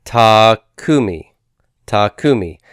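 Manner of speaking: takumi com a primeira sílaba marcada; stress on root one. Shown as a demonstration. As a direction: say takumi leaning on the first syllable, slowly. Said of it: "I'm continuing to try to stress the vowel that goes with root one".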